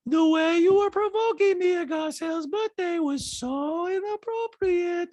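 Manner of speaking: high, slow put-on voice; Diana. Diana scolding Sutton over the party, high slow impression. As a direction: mimic her scolding, stretched and high. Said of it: "And Dinah goes".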